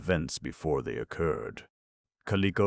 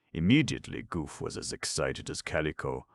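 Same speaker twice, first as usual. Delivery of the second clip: ordinary delivery, nothing marked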